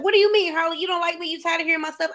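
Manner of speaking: hurt lonely client. Retold or imitated. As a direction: play the wounded friend who feels rejected. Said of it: "people who suffer from loneliness are immediately like".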